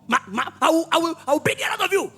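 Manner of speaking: high, sputtering threats; angry teacher. Acted. Teacher orders laughing pupil to be flogged, furious. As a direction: stammer orders in a rising temper.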